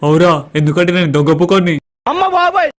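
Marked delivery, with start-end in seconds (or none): 2.1-2.7 high startled yelp of alarm; shocked cry. Acted. Startled shopkeeper yelps high in shock. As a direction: jump in fright at the news.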